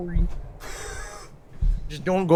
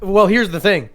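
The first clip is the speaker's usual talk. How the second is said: normal talking voice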